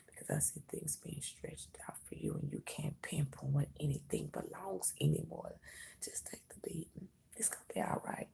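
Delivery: hushed whisper. Real, not acted. Whispers the reading.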